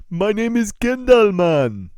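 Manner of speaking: loud, high Jamaican introduction; hammed-up Jamaican voice; Jamaican accent. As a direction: announce it in a big Jamaican accent. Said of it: "Of course I met a Jamaican".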